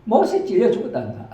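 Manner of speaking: high, loud Gyeongsang granny explaining; Gyeongsang grandma. Acted. Grandma explains he was killed by nails, high and loud in Gyeongsang dialect. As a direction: squeak it out like an old country woman. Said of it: "경상도 버전으로".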